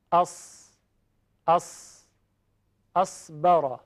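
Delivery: اس with a thick, loud hissing sad; heavy emphatic sad. Shown as a demonstration. Teacher showing the class a thick, emphatic sad sound. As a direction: push the air hard through the teeth on اس.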